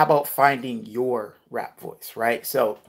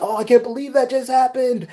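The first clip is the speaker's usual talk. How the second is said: much higher and louder, bursting with disbelief; excited gasp of disbelief, voice jumping way up; hyped-up excitement; 13 semitones higher, 7 dB louder than usual. Shown as a demonstration. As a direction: sell it like something wild just happened in front of you. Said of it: "really getting hype and excited".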